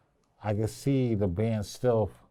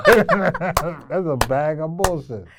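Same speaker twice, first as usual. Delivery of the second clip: slower, louder, laughing through it; slow laughing repeat; 5 semitones higher, 8 dB louder, slower than usual. Retold or imitated. Coach repeats the harsh verdict to his student, slow and laughing. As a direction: savor the joke, slow and laughing. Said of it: "I could've been like".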